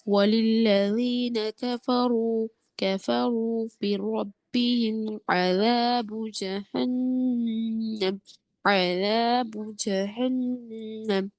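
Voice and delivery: slow, drawn-out tajweed chant; Quran recitation. Shown as a demonstration. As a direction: recite slowly, stretching the long vowels. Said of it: "ذال کا مخرج اچھا لیجئے".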